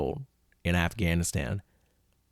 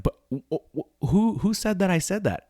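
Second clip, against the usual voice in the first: flustered disbelief; 10 semitones higher than usual. Retold or imitated. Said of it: "And he's like".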